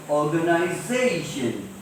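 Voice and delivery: "organization" slowly, with ZA stretched; stressed syllable before -tion. Shown as a demonstration. Teacher showing the rule, slow stress on ZA in "organization". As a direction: draw out the ZA in "organization". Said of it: "So how do we pronounce it?".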